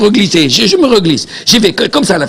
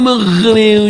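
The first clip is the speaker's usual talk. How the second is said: normal talking voice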